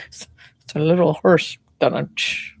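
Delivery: usual speaking voice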